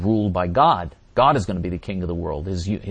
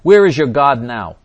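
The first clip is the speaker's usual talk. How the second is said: a louder, slightly higher jeer; imitated Nazi taunt; 4 semitones higher, 10 dB louder than usual. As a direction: throw it out as a loud challenge, lightly acted. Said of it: "German Nazis ... in the death camp said".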